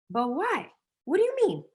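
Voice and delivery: high, squeaky mock disbelief; incredulous. To sellers scared of the word, high baffled disbelief.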